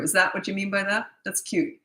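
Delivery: ordinary talk, nothing marked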